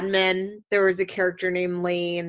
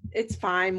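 ordinary talk

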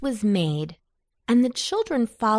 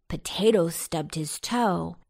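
ordinary delivery

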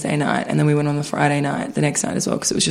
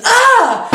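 high mock yelp; startled yelp; 4 dB louder than usual. Imitating the startled man with a high yelp.

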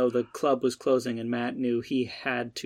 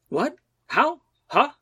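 startled babble; 12 semitones higher, slower than usual. Acted. Matt shocked by monster.

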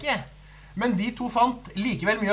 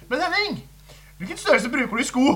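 much higher, sugary mock sales pitch; flirty seller voice; 7 semitones higher than usual. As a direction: coo the question up high like a flirting saleswoman. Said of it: "prøver å spørre han". (høy, flørtende selgerstemme)

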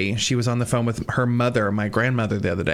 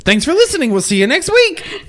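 high, loud chirpy sign-off; mock chirpy outro; 6 dB louder than usual. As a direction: mimic a cheery host. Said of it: "I love how you went from".

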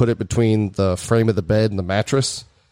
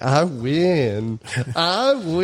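gloating, the second one pitched way up; singsong gloat, climbing higher; mock triumph; 6 semitones higher, much slower than usual. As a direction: draw out a smug little victory cry. Gloating after outlasting drill sergeant.

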